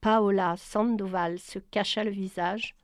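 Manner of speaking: usual speaking voice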